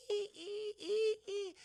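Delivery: high, thin squeaked creaking; imitated creaky squeal; rocking chair creak.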